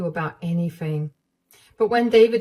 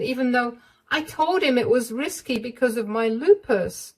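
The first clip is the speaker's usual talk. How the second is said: higher, pleading voice; higher, hesitant confiding voice; frightened young wife; 8 semitones higher than usual. Acted. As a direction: beg her not to be angry. Narrator voicing the frightened wife.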